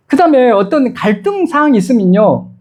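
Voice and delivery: ordinary delivery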